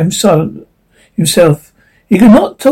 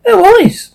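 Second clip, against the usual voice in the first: higher, firm insistence on No; emphatic; 14 semitones higher than usual. A narrator's reading. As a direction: insist hard on No, honest. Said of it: "repeated emphatically".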